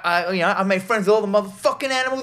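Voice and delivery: high, fast bragging rant; hammed-up excited boaster; mock bragging hero. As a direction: brag about your animal friends, quickly. Retelling character bragging about animals.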